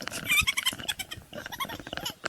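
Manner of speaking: shrieking laugh up high; high squealing laugh. Real, not acted. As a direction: let the laugh fly up high. Cracking up after Star Trek bit.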